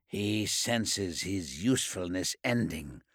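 soft, low private thought; inner thought. Acted. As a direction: muse it darkly to yourself.

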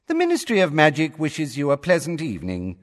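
high, formal female announcer; cool female voice. A narrator's reading. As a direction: impersonal official sign-off, pitched high. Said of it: "said the woman's voice".

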